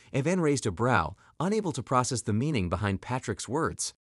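usual speaking voice

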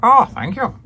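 flattered prim butler voice; imitating Alfred. Alfred thanking Pat for the compliment, high mock British. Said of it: "I just love the way he sounds".

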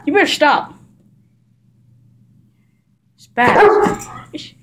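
high, loud warning at the dog; scolding the overexcited dog, high and loud. Real, not acted. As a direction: tell him off sharply.

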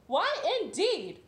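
high, loud, slow hen cluck; mock hen cluck. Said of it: "clucked Nadine".

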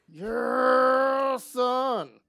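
high, very loud drawn-out whoop; exultant shout. Real, not acted. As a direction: belt it out in triumph, stretching the first word. Cheers at finding thick grass.

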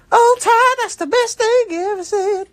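very high, singsong mother voice; high mom voice. Pretend. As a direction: sing it like a doting mom. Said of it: "His mom talks like that?".

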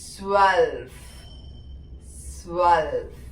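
"സ്വൽഫ്" as German zwölf, swelf with long final f; very slow, stretched "സ്വൽഫ്" for learners; German pronunciation demo. Shown as a demonstration. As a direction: draw out "സ്വൽഫ്", s-w at the start, hissing f to end. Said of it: "ട്വൽവ് നമ്മൾ പറയാ".

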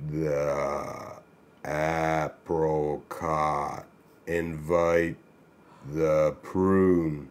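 extremely slow, low, every word stretched; low, drawn-out slow-motion voice. Acted. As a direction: drag each word out as if played at reduced speed. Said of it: "Read the joke in slow motion".

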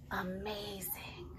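breathy hushed whisper; slow awed whisper. A narrator's reading. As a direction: hush it like a secret reveal. Dramatic whisper of story climax.

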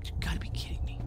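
hushed, unvoiced groan; whispered disbelief. Real, not acted. Disbelief at game trap.

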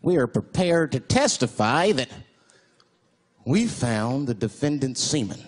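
low, slow mock-official testimony; forensics witness. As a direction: testify formally, drawing out the words. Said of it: "Forensics was like".

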